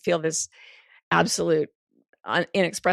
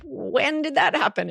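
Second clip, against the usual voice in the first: surprised; 8 semitones higher than usual. Retold or imitated. Retells her own surprised question.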